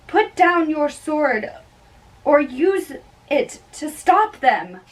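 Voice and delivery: usual speaking voice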